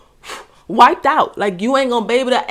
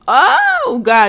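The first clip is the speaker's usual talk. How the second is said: overwhelmed oh; 5 semitones higher, 7 dB louder than usual. Real, not acted. Overwhelmed reaction at song's end.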